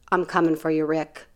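low, soft imitated warning; quiet threat. Bounty hunter vows to catch Rick, quiet threat. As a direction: sink low and hushed on the name.

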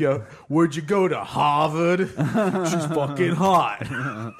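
put-on loud, drawn-out Boston bro accent. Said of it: "let me translate it for you".

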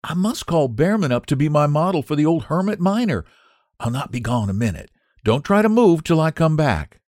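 put-on high, bright young woman's voice; young Sue. Sue's voice in the story.

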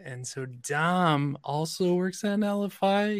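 "Netlify" lifted as if unsure; statement with a climbing, questioning tune up to "Netlify"; questioningly. Real, not acted. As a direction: slowly turn "works at Netlify" into a doubtful question. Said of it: "why you say it so questioningly".

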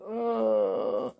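ordinary delivery

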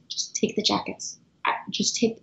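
轻声细语地说; hushed kindness. Retold or imitated. Kind cashier quietly offering jackets.

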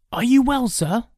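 put-on high boyish voice; concerned boy. Boy checking on the attacked old man, concerned. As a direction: ask with high-pitched concern.

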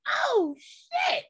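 very high shocked cry; high, drawn-out reaction of disbelief; shocked outburst. Retold or imitated. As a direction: re-enact your shock, voice shooting way up.

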